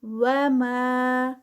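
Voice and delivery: وما with ما stretched four counts; slow recitation. Shown as a demonstration. Teacher models the madd, slow recitation. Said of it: "ما میں مد منفصل".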